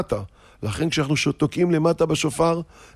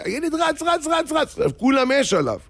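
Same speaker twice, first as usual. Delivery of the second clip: excited chase; 10 semitones higher than usual. Retold or imitated.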